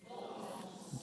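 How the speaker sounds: whispered. Shown as a demonstration. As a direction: whisper the word boldness with no voice. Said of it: "speak, say it out loud".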